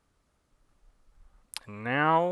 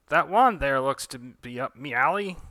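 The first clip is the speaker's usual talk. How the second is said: louder, higher hammed-up pirate; mock pirate; 4 semitones higher, 9 dB louder than usual. Darla eyeing the wand in fake pirate voice. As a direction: growl it like a stage pirate. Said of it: "pretending to be a pirate".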